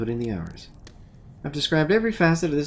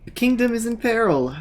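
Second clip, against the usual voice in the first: high, loud royal proclamation; booming warrior king; 10 semitones higher, 5 dB louder than usual. Acted. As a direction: boom it out like a king in a crisis. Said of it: "that's gonna be like a kingly voice".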